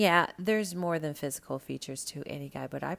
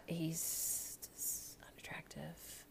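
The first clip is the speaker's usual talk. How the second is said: whisper; 17 dB quieter, much slower than usual. Real, not acted. Blunt verdict on corny contestant.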